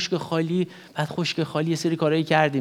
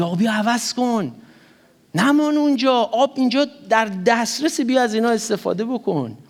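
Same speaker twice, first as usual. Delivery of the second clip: Jesus pleading; 6 semitones higher than usual. Retold or imitated.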